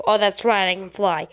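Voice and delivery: ordinary talk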